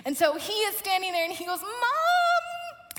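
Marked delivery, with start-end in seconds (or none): 1.6-2.9 high, long drawn-out mock wail; imitated child's wail. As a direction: whine for mom like a desperate kid. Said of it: "he starts crying".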